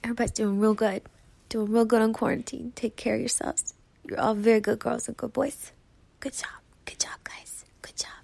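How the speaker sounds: gentle hushed praise; soft soothing voice. Pretend. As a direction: soothe the audience like pets. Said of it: "You guys want me to talk to you like that?".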